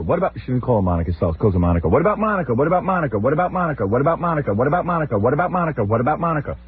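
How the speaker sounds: mother-in-law pestering father-in-law, high repeated nag; nagging repetition. Retold or imitated. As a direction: drone it over and over till someone listens. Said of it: "That's how she just says it".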